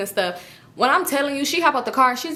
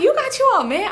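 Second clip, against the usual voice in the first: high mock surprise; 11 semitones higher, 3 dB louder than usual. Friend's surprised question, retold.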